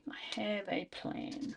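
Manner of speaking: slow conspiratorial whisper. Shown as a demonstration. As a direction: breathe it like a secret plan. Repeats plan in whisper.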